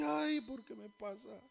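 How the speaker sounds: softly whined mock lament; mock tearful complaint. Preacher imitating believers who only cry at problems, mock tearful. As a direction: imitate a weepy, self-pitying moan. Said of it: "puro llorando y viendo el problema".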